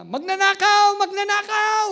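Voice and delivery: loud, high yell of "Magnanakaw!"; shouting thief. Retold or imitated. Neighbour shouting at the preacher, retold loud high yell. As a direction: yell it across the street. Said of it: "may sumisigaw na".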